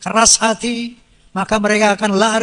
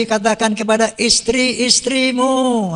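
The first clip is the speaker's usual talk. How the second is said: ordinary talk, nothing marked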